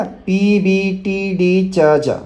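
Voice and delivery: each letter drawn out slowly, one by one; slow letter recital. Shown as a demonstration. Teacher slowly reciting the letters for a student. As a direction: spell out the letters very slowly for beginners. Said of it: "इसको चेजा नहीं पढ़ना".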